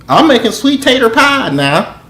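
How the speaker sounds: high, loud southern protest; exasperated. Real, not acted. Interrupted cook telling phone caller he is busy, exasperated. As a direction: raise pitch and volume in exasperation.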